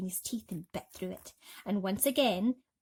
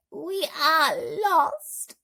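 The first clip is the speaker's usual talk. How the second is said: very slow, high, louder wail; despairing cry; 8 semitones higher, 9 dB louder, much slower than usual. A narrator's reading. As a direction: stretch out the despair. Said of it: "cried the ladybird".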